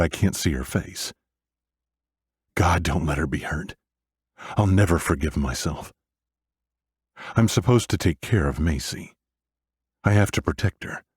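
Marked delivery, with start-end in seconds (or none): none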